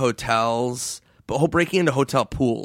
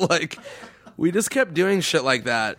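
ordinary talk, nothing marked

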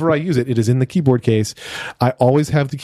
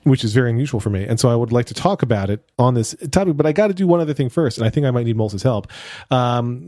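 usual speaking voice

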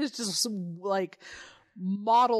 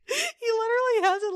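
high, louder, laugh breaking in; can barely say it for laughing; 12 semitones higher, 5 dB louder than usual. Real, not acted. Describing the man staring at milk, cracking up.

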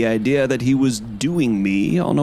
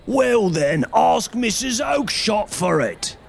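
angry shout; 10 semitones higher than usual. A narrator's reading. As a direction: yell him off the stall. Salesman yelling at pestering man.

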